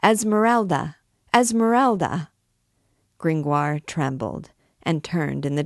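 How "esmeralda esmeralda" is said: high, raised crowd shout; high calling cry, falling at the end. A narrator's reading. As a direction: cry the name out as a crowd would. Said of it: "a shout rose from the thieves".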